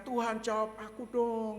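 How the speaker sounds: high, slow plea to God; Daud. Acted. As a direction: plead with God, drawing it out. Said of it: "dia berani mengatakan". (memohon kepada Tuhan dengan nada tinggi)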